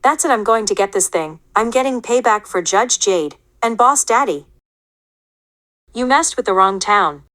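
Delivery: loud, determined payback threat; fired-up hero voice; angry vow. Acted. Toy skit, figure vows payback.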